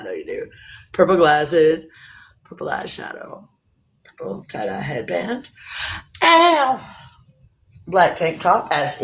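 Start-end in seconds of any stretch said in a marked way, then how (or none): 2.4-5.5 soft, breathy whisper; whispering the outfit details to chat; whispered showcase. Acted. 6.2-6.8 high, loud sassy yelp; a sassy yelp while showing the outfit; playful ow. Acted. Playful exclamation.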